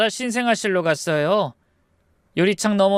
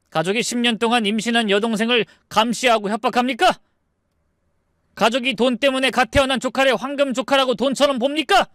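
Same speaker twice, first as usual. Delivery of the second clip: heated, higher-pitched rebuke; indignant accusation; 6 semitones higher than usual. Acted. Father confronting his wife's greedy brothers, indignant. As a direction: accuse them with mounting pitch.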